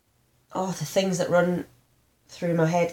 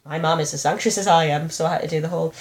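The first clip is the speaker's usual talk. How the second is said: ordinary talk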